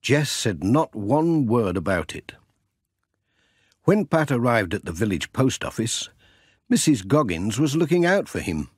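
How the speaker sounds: ordinary delivery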